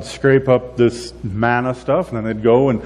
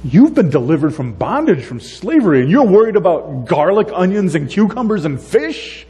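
exasperated Moses; 7 semitones higher than usual. Retold or imitated. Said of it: "And Moses is like".

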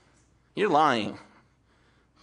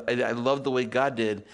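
usual speaking voice

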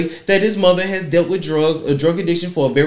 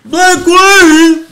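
very high, loud theatrical cry; 13 semitones higher, 11 dB louder than usual. Retold or imitated. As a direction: belt it like an overwrought singer.

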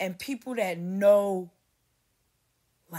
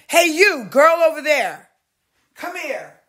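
high loud summons; bossy loud call; 8 semitones higher, 14 dB louder than usual. Retold or imitated. Mimicking Hollywood staff ordering a girl, loud and high. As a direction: call her over like staff. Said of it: "This how they treat you in Hollywood".

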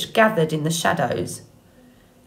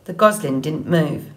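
usual speaking voice

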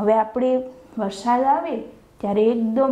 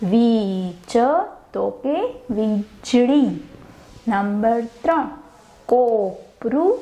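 each word slowly spaced for copying; teacher dictating word pairs; slow dictation; 4 semitones lower, much slower than usual. Shown as a demonstration. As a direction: read the answers out slowly for the class to write. Dictates synonym answers.

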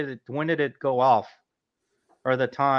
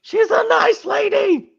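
high silly voice; 8 dB louder, slower than usual. Pretend. Joke voice about Tex.

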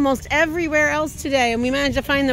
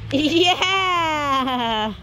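long drawn-out "yeah"; drawn-out celebratory yeah; cheering. Real, not acted. Cheering child down the slide.

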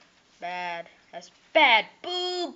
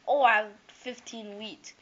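normal talking voice

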